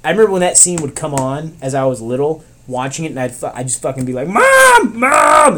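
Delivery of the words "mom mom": high, loud mock scream for mom; shrieked reenactment of childhood panic; scared child's yell. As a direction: imitate a frightened kid screaming for mother. Acts scared child calling mother.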